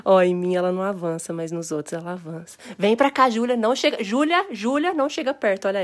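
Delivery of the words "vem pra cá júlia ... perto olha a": warning call. Real, not acted. Warning child away from cat.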